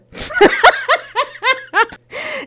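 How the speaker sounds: big high burst of laughter; cracking up hard; loud laugh. Real, not acted. Laughing at own joke.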